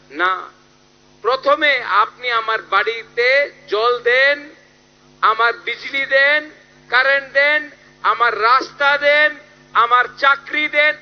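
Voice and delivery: high, forceful rally-speech list of demands; Bubagra. Retold or imitated. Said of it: "आपका हा बुबागरा है, जो बोल रहा है".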